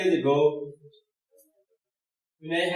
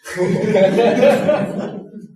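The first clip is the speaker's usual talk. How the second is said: burst of laughter; 7 semitones higher, 11 dB louder than usual. Real, not acted. Audience laughs.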